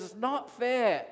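retold tantrum line; mock child's yell. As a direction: imitate a whining child, no real volume. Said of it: "yelling and screaming".

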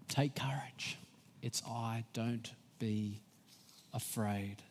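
hushed, breathy whisper of comfort. Shown as a demonstration. Preacher whispering Jesus' comfort to a frightened listener. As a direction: whisper it like a secret promise.

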